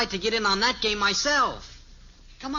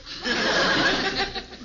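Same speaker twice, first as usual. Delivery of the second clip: amused laugh; 10 semitones higher than usual. Acted. Laugh after joke.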